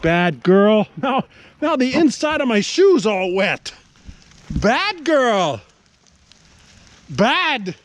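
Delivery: cross dog-owner rebuke; stern scolding. Real, not acted. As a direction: scold the dog sharply and loudly.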